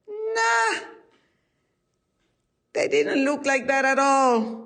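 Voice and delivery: high, loud dismissive interjection from a story character; emphatic contradiction. Acted. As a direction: butt in high and forceful, flatly disagreeing. Unseen old woman contradicts the rabbi.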